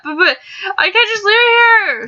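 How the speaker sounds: high, whiny mock-tearful plea; mock pleading. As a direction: pretend to be heartbroken about leaving it. Pleading to keep the dog.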